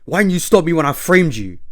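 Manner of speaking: shouted accusation at the climax; villain outburst. Acted. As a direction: explode at the betrayer.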